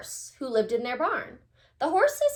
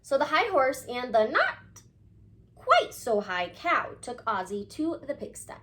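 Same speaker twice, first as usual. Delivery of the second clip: ordinary delivery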